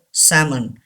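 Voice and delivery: salmon with the L silent, "SAM-un"; salmon as "sammun". Shown as a demonstration. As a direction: say salmon with no L sound at all. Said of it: "L is silent here".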